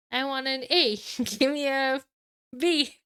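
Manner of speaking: perky high cheerleader chant. Pretend. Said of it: "read that as in a, like, a cheerleader's voice".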